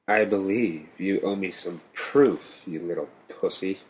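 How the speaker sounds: icy and menacing; cold demand. A narrator's reading. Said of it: "Nate demanded coldly".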